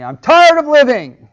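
high, loud anguished cry; burst out. Retold or imitated. Rebecca bursting out to Isaac, high and loud. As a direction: wail it out in despair. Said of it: "she burst out".

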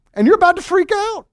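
high, loud mock panic, voice shooting up; about to freak out. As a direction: play the frazzled parent about to snap. Parent losing it at dinner.

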